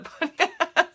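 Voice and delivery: giggling through the words; laughing at own slip. Real, not acted. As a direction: crack up while talking.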